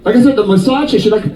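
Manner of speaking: usual speaking voice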